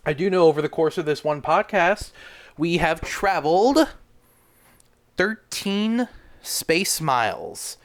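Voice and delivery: thrilled captain's log announcement; excited announcing. Acted. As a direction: hype up the space news like a showman.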